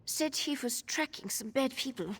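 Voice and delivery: ordinary delivery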